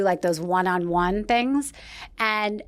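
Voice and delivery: ordinary delivery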